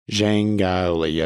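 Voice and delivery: Zhang Gaoli said slowly, syllable by syllable; careful name pronunciation. Real, not acted. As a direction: take care over each syllable of Zhang Gaoli. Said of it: "I think I may have pronounced that right".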